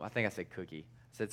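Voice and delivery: ordinary talk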